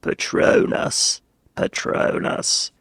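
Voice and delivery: slow, creaky vocal-fry incantation. Acted. Harry Potter casting a spell, in vocal fry. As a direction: drag it out in heavy fry. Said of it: "Harry Potter has vocal fry".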